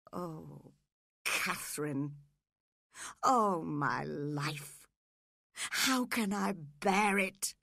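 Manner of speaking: slow, drawn-out lament; slow anguished exclamation; anguished cry. A narrator's reading. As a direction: cry out in despair, drawing each word out. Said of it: "he cried".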